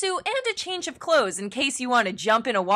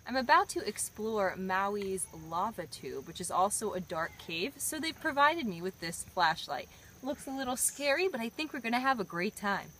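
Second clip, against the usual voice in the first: hushed voice; 3 semitones lower, 8 dB quieter than usual. Real, not acted. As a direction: speak softly at the cave mouth. Whispering before entering cave.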